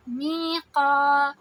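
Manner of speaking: میقا slow and loud, heavy قاف; Quran recitation. Shown as a demonstration. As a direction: hold both vowels in میقا two counts. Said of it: "قاف کو heavy کیا". (میقا میں قاف بھاری اور دو حرکہ)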